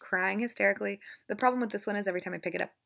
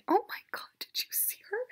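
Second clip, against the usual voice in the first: shocked whisper; 11 semitones higher, 4 dB quieter than usual. Real, not acted. Shocked aside about cat.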